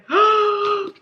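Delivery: high-pitched gasp; excited gasp. Real, not acted. Reader recognising the character in the excerpt, thrilled gasp.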